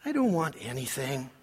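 low, soft imitated wife; understated, soft-spoken refusal; demure wife. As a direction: decline quietly, as the wife. Acting wife's demure reply.

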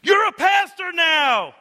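high, loud, drawn-out scream; wife screaming from the passenger seat. Retold or imitated. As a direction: scream it at the top of your lungs. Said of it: "my wife screamed at the top of her lungs".

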